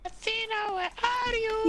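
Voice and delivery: high singsong calling out. Pretend. Teammate calls Athena across the game, high singsong. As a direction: call a friend in a very high voice.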